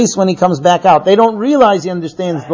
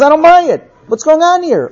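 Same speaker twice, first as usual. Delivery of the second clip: raised, disbelieving father; the old father won't buy his sons' story; incredulous Yaakov; 8 semitones higher than usual. Acted. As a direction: push back high, refusing to believe them. Yaakov doubts his sons.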